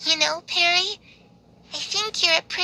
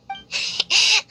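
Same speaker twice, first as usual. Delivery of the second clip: a bright teasing giggle after a joke; light mischievous laugh; playful giggle; 5 semitones higher, 3 dB louder than usual. Acted. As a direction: giggle as if caught fooling around. Teasing about skipping class.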